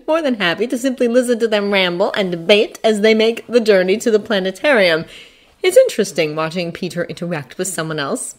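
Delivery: ordinary delivery, nothing marked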